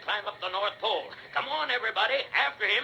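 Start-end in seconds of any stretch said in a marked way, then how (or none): 1.3-2.8 rallying call. Acted. Santa rallying the chase.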